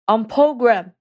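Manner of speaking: loud barked call to stand; shouted command. Retold or imitated. Shift leader shouting at prisoners to stand, loud. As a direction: call out the order to the whole floor. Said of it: "他就会大喊".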